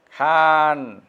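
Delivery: খান stretched on a long vowel; drawn-out word. Shown as a demonstration. Teacher telling students how to pronounce it, খান drawn out. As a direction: hold the vowel of খান before closing it. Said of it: "খান টানে উচ্চারণ করো".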